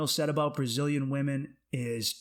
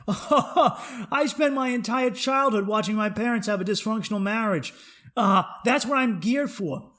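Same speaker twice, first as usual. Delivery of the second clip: raised, loud scoffing after a laugh; mocking inner voice; 7 semitones higher, 5 dB louder than usual. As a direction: scoff loudly as the saboteur within.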